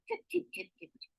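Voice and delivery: tiny, soft, high clip-clopping; light high trotting noise; scampering hoof sounds. Acted. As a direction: patter away like little hooves. Little goat's hooves running off.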